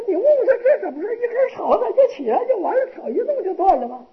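high-pitched, incredulous princess voice; princess's voice. Acted. Princess asking her servants about the bound monk, high and incredulous.

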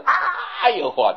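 mock scream of agony, drawn out; miserable scream. Preacher warning eaters of hell to come, acted miserable scream. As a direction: let out a loud, high, slow "aiyo" of pain. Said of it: "就变成这样的惨叫声".

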